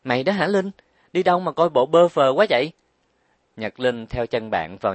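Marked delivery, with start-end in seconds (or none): 0.0-2.7 higher, louder surprised greeting; startled to see her friend so worn. A narrator's reading. Trang Đài greeting her exhausted friend, surprised and concerned. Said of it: "ánh mắt Trang Đài đầy vẻ ngạc nhiên".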